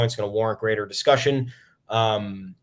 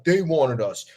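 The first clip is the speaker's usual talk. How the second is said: mock Houston complaint; 4 semitones higher than usual. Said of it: "Houston doing this whole campaign of, like".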